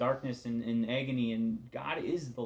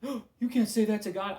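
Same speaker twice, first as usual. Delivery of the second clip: high, loud, mock-horrified; shocked churchgoer, much higher and louder; scandalized gasp; 9 semitones higher, 8 dB louder than usual. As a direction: clutch your pearls at the blasphemy. Mock shocked reaction to honest prayer.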